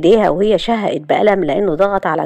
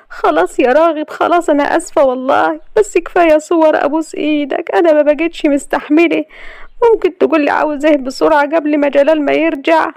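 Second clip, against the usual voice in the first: grovel, panicked; desperate begging; 10 semitones higher than usual. A narrator's reading. Layla desperately begging Ragheb to stop, high and pleading.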